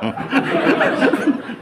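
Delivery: high pitched laugh; high laughter. Real, not acted. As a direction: laugh high at your own joke. Host laughs after joke. (mataas na tawa)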